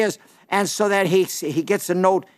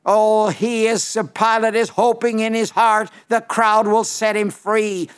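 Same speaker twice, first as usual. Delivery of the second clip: ordinary talk, nothing marked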